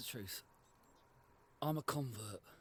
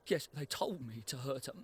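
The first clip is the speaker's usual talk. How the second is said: normal talking voice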